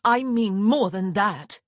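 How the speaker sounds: put-on a stern older sister putting her foot down. A narrator's reading. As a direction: lay it down sternly, word by word. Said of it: "Honor said sternly".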